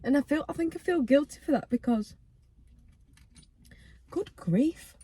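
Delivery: breath catching mid-sentence, near tears; fighting back tears, breath hitching; catching breath midword. Real, not acted. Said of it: "I can't talk, sorry".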